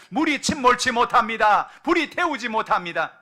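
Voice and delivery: full-volume sermon; preaching at full force. Real, not acted. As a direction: build to a fervent climax. Preaching climax.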